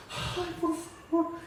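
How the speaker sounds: high, slow, drawn-out whine of despair; exasperated whine. Retold or imitated. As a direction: moan it like a fed-up believer. Quoting own exasperated complaint.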